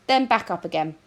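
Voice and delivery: ordinary delivery, nothing marked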